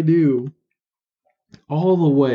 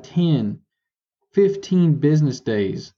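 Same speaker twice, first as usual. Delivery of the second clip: ordinary delivery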